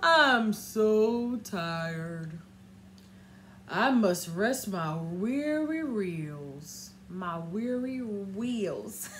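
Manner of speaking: put-on low, slow, weary old engine voice; tired old engine. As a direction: drag it out like a worn-out old train. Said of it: "the old rusty engine sighed".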